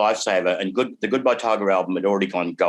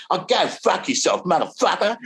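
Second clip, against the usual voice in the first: mock retort; 9 semitones higher than usual. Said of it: "I went".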